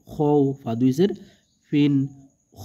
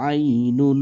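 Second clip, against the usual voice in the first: normal talking voice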